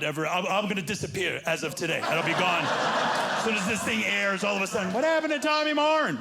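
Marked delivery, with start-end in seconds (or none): 4.9-6.2 high, louder mock puzzled voice; mock wondering public. Mock public asking where the comic went.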